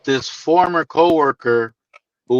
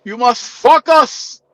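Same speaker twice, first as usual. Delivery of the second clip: slow, higher mock Greek coworker, "fuck us" for focus; heavy Greek accent; 7 semitones higher, slower than usual. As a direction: repeat the coworker's line in his thick accent. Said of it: "That's that heavy ass accent".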